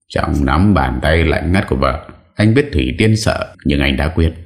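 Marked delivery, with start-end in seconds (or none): none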